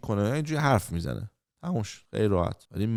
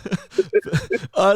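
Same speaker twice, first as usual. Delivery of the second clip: loud laugh; 10 semitones higher, 4 dB louder than usual. Real, not acted. Laughing at the smoking story.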